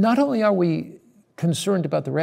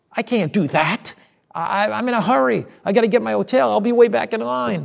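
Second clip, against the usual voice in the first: higher, protesting he's in a rush; a pitched-up mock protest; hurried excuse; 6 semitones higher, 3 dB louder than usual. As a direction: whine that you're too busy to stop. Inner excuse to the Lord.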